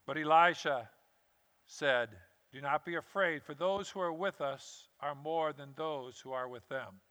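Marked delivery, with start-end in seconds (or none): 2.5-7.0 soft, steady, unhurried reassurance; calm, assured prophet. Acted. Elisha calms his terrified servant: soft steady reassurance. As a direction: settle his fear quietly.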